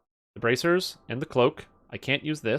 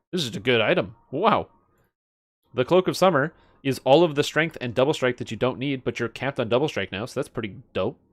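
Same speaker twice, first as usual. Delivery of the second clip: ordinary talk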